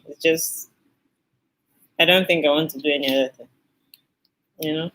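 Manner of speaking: usual speaking voice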